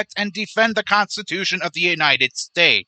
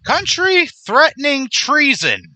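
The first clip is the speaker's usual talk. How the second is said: slow, high, louder disbelief; incredulous echo; 7 semitones higher, 4 dB louder, slower than usual. Real, not acted. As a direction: throw the accusation back in indignant disbelief. Throws quoted accusation back, incredulous.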